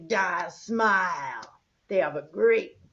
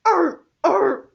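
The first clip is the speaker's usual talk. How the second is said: seal bark; 9 semitones higher, 10 dB louder than usual. Shown as a demonstration. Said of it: "That's it".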